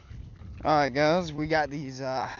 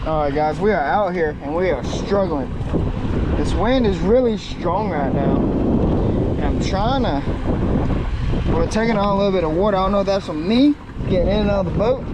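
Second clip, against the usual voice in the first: shouting over wind; 3 semitones higher, 8 dB louder than usual. Real, not acted. This wind is really strong right now.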